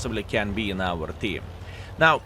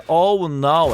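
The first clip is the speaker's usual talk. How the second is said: a little louder, mock panicked oh no; 4 semitones higher, 5 dB louder than usual. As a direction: play the panicking enemy lightly. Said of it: "full panic mode is saying".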